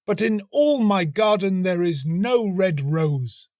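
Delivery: put-on high, slow, pleading young student. A narrator's reading. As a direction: ache for the roses, lifted high and slow. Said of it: "cried the young student".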